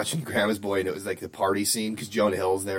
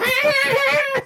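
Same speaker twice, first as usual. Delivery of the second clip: high shrieking laugh; loud laugh; 6 dB louder than usual. Real, not acted. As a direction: burst out laughing. Laughing at the scene.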